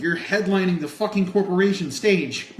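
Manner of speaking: loud, high, incredulous retort; retold outraged call-out; exasperated heckle. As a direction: shout back at the hypocrite onstage.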